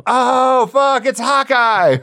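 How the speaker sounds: high, drawn-out cry of horrified discovery; hammed-up startled realisation, breaking into a laugh; mock shock. Comedian retelling opening the box of ashes, hammed-up shock.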